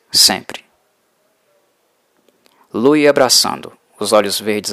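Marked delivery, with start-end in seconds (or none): 0.1-0.7 a breathy whispered sempre; whispered echo. A narrator's reading. Louis's dream words echoed, whispered.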